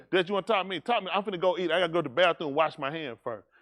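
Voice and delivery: ordinary delivery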